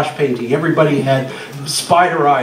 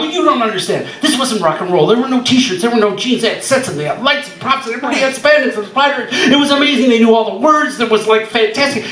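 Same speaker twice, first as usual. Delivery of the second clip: much higher, gushing, the spandex line fast; breathless pitch; 6 semitones higher than usual. Retold or imitated. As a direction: sell the band like a wide-eyed kid. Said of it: "I go".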